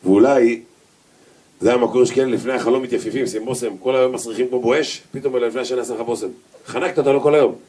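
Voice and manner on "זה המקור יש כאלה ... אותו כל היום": normal talking voice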